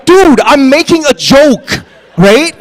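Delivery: high, loud, slow plea; exasperated protest. Retold or imitated. Retelling his protest to the co-star, high and loud. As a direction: make him see it's only a joke. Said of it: "I'm looking at him going".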